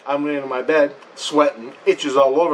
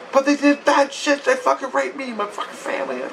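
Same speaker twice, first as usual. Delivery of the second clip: upset protest; 9 semitones higher than usual. Retold or imitated. Protesting to the priestess.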